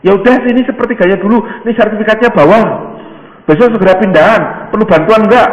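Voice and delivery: high, loud, eager seller's pitch; very enthusiastic. Acted. House seller urging a favoured buyer to purchase, very enthusiastic. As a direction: press the buyer with keen, pleading insistence. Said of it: "kayaknya semangat sekali".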